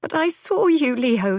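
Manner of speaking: high, hurt voice near tears; tearful. A narrator's reading. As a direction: hold back the tears. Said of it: "tears glistening in those stormy eyes".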